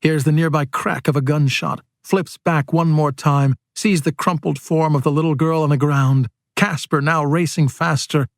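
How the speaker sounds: plain reading voice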